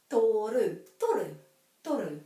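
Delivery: toru with a short pure o and tapped r; pure Māori o. Shown as a demonstration. Teacher models toru, pure o and tapped r. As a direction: say toru, o never a glide. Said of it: "this is always o".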